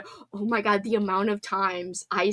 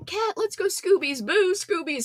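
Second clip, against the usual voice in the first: normal talking voice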